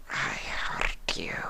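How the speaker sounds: flat unvoiced whisper, no inflection; toneless. A narrator's reading. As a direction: say it empty, without feeling. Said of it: "the changeling said tonelessly".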